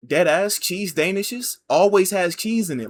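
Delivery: ordinary delivery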